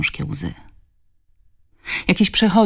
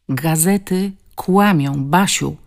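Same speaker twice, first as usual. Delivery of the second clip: "Gazety kłamią" slow and weighted, "Basiu" falling; insistent, drawn-out "kłamią"; emphatic; slower than usual. A narrator's reading. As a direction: press each word of "Gazety kłamią", slowly. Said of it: "oznajmił z naciskiem".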